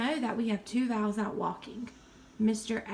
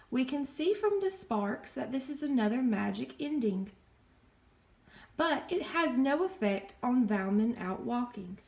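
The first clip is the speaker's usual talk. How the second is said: normal talking voice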